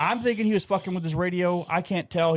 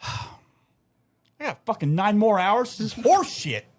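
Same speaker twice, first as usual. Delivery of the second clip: weary complaint; 7 semitones higher, much slower than usual. Pretend.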